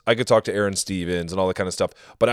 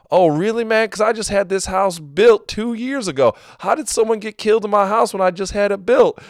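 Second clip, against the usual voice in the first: higher, mock-innocent caller voice; switching voice; 11 semitones higher than usual. Crank caller pitching up to needle the psychic. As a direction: drop the act, pitch up and play dumb. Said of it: "I'm like".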